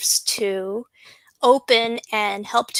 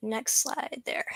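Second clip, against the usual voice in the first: whisper; 2 semitones higher, 10 dB quieter than usual. Real, not acted. Aside to slide operator.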